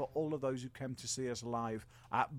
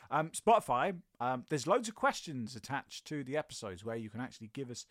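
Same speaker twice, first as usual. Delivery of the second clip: normal talking voice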